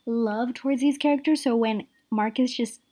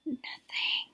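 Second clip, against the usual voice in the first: breathy whispered Nothing, much softer; 2 semitones higher, 10 dB quieter than usual. Real, not acted. Reviewer sighs out that the plot meant nothing, whispered.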